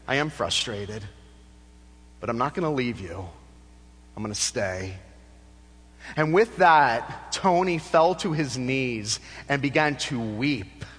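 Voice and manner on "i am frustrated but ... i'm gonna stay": a hushed, lower-voiced counselor, lightly voiced; quiet counselor voice. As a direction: say it softly and low, as the counselor. Counselor answers Tony gently.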